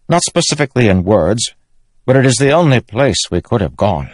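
earnest, mildly accusing king; insistent young king. Acted. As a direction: lay it at your uncle's door. Narrator voices Elhokar.

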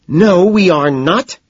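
high, loud, slow retort; angry denial. A narrator's reading. Evelyn answers whether they're having fun, angry denial. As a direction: stretch out a furious no. Evelyn angrily contradicts him.

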